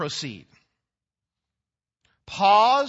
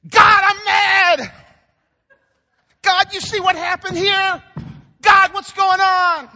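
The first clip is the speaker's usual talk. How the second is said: shouted much higher and louder, slowly; honest angry prayer; 13 semitones higher, 11 dB louder than usual. Shown as a demonstration. Preacher demonstrating honest prayer, shouting out angry. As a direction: yell your anger straight at God. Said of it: "Pray like this".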